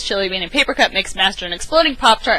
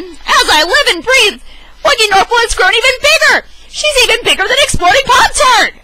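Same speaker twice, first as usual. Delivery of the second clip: high, loud astonished dad voice; wide-eyed storybook exclamation; amazed Lunchbox Louie; 14 semitones higher, 7 dB louder than usual. Acted. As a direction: marvel at how big the cat grew.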